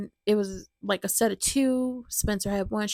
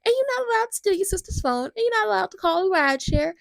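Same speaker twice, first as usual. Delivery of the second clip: high, strict scolding mom voice, louder toward the end; mom laying down the law; 9 semitones higher, 4 dB louder, faster than usual. Retold or imitated. Mom scolding her son, high mock-stern voice. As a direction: list the rules like a fed-up mother. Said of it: "And Max is like".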